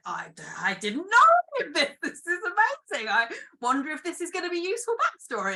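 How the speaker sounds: high-pitched mock thrill at a discovery; retold amazed excitement. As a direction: gush it high, like you've just struck gold. Acts out delighted reaction to anecdote.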